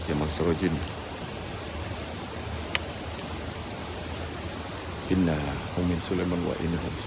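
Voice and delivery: low, soft incantation, words murmured; murmured prayer recitation. Acted. Ghost hunter murmuring prayers at a haunted site, low and hushed. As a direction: recite the spell low and hushed as if not to wake the spirits.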